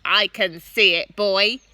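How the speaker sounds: loud granny answer; a hint of an old woman calling back; shouting back. A narrator's reading. Grandma shouting back to George. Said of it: "the old girl shouted back".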